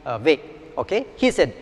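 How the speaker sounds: ordinary talk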